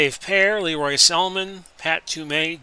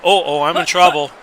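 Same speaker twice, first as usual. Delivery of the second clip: quick, louder oh no, I'm in trouble; real panic at his own bad call; alarmed; 3 semitones higher, 8 dB louder, faster than usual. Real, not acted. Player to viewers, alarmed at his wrong call.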